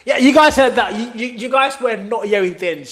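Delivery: loud burst of laughter, then talking; laughing. Real, not acted. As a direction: laugh through the words. Laughing at cohost's dentist joke.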